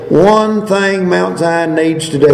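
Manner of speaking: usual speaking voice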